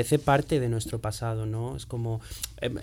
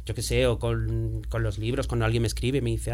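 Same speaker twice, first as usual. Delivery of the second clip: usual speaking voice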